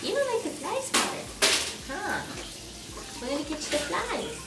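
high, sweet pet voice; talking to a dog. Real, not acted. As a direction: coo at the puppy.

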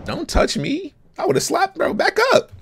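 high-pitched, indignant protest; mock indignation. Watcher rejecting a character's comfort, high mock protest. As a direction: push the voice way up, acting offended.

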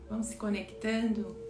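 soft, unhurried phrases with long pauses; guided meditation. Acted. Guide leading listeners into thanks for another day, soft meditation voice. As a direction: lead them quietly into gratitude, letting each phrase settle.

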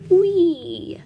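high, delighted squeal of fun; gleeful whee. Acted. Water drop cheering as it slides down. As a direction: sing it out like sliding down a slide.

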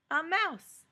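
bright excited answer; excited reveal. Acted. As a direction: announce the mouse with glee.

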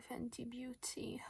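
breathy dramatic whisper of the brand; whispered name. Acted. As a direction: hush it like a secret.

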